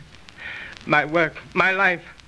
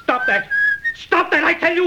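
high, sharp cry to make it stop; angry outburst; 10 semitones higher than usual. Acted. Alan cries out at William's whistling, high and angry. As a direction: yell it, nerves jangled.

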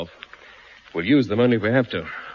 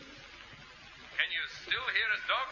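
slow, very soft, high call over radio; far-off voice nearly lost; faint signal fading out; barely audible radio; 8 semitones higher, 12 dB quieter, much slower than usual. Acted. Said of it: "but only just".

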